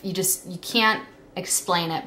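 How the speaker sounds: ordinary talk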